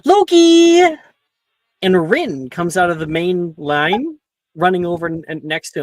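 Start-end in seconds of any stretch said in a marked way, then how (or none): 0.0-0.8 high, loud call across the room. Acted. Rin calling to Loki from the line, loud call. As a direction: call his name from far back.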